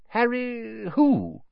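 high, slow, baffled question; blank. A narrator's reading. Husband asking his wife about a guest, blankly baffled. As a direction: stretch it out, utterly puzzled. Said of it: "said Mister Weasley blankly".

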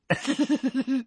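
dazed high-pitched giggle; weak high giggle. A narrator's reading. Ron giggling into Harry's ear, high. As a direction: giggle weakly. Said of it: "still giggling weakly".

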